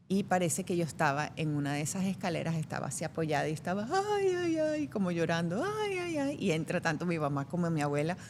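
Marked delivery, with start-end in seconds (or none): 4.2-4.9 high whimpering child's mock sob; playful mock crying; fake crying. 5.6-6.4 high whimpering child's mock sob; playful mock crying; fake crying.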